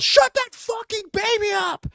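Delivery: high, slow mocking yell; imitating a yell. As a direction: ham up an angry moviegoer's shout. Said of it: "She turns around and yells".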